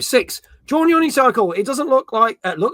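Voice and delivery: ordinary delivery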